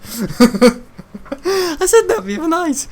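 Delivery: high, helpless laughing talk. Real, not acted. Speaker amused at his own odd phrase, laughing hard. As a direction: squeeze the words out through real laughter.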